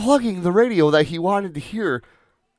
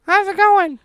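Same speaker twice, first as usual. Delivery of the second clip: high-pitched wife voice; 4 dB louder than usual. Acted. Acted phone call with wife.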